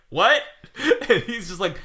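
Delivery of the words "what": confused what. Retold or imitated. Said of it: "we're just like".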